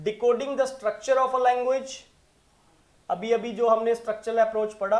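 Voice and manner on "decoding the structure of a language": slow answer reading. Acted. Reads answer option slowly.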